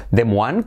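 DES MOINES as French-style "de-MWAN"; former mistaken pronunciation. Retold or imitated. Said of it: "como yo decía".

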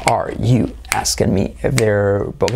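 low, slow, evenly beaten phrase; stretched rhythmic model sentence; rhythmic drill. Shown as a demonstration. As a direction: keep the slow beat going for learners. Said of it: "la hacemos con ritmo".

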